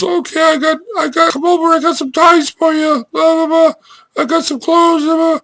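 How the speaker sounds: put-on high squeaky old man voice; little old guy squeaking on the phone; Earl's squeaky old-man voice. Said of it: "he says".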